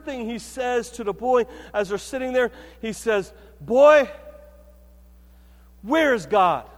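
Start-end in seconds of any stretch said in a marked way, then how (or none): 3.6-6.7 loud, slow and stern; a burly pastor's booming question. Retold or imitated. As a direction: boom it at the boy like a big preacher. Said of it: "this big booming voice".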